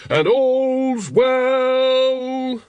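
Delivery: high, loud, very slow town crier bellow. A narrator's reading. Carrot bellowing the watchman's call across the street. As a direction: belt the hour out across the street. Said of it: "he bellowed".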